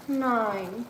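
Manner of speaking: ordinary delivery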